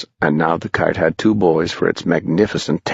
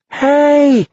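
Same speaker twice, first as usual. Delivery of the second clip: very high, loud whoop; loud shout; 5 dB louder than usual. Acted. Boys swept up by the kite, loud high whoop. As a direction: shout it to the sky.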